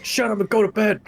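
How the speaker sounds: loud, high mock-angry telling-off; scolding shout. Player scolds companion to go to bed, loud mock-angry shout. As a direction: shout them down, half laughing at the start.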